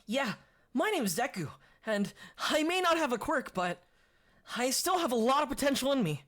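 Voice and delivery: put-on earnest young Deku introducing himself, a touch fast. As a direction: chirp it out like an underdog hero psyching himself up. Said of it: "I need to try a Deku voice".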